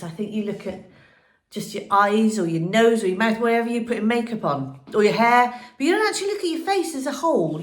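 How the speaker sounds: ordinary talk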